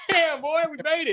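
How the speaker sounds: high, excited laughing cheer; thrilled. Real, not acted. Host laughing, thrilled that Randy noticed him. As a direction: cheer it like you won. Thrilled retelling of birthday message.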